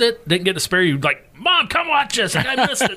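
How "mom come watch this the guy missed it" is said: put-on high, eager kid calling his mom; thrilled kid pulling mom over to watch; excited kid.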